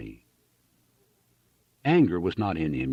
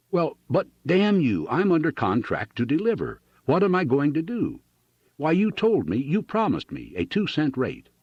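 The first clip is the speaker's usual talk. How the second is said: high-pitched, desperate indignation; angry protest; 8 semitones higher than usual. A narrator's reading. Farmer under contract to clerk, angry protest.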